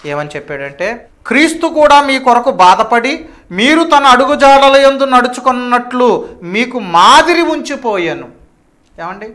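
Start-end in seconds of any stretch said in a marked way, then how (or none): 1.3-8.3 loud, high, ringing verse quotation; scripture declamation. Acted. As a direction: proclaim each phrase to the congregation. (బిగ్గరగా, హెచ్చు స్వరంతో వాక్య ప్రకటన)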